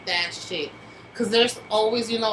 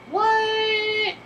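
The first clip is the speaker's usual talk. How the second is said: high, drawn-out incredulous what; a stunned squeak of disbelief; shocked what; 12 semitones higher than usual. Retold or imitated. Shocked reply to dancing idea.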